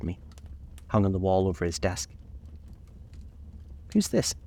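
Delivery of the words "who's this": high, bright-voiced Daisy asking; a young woman's curious question; Daisy's voice. A narrator's reading.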